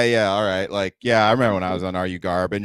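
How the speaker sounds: normal talking voice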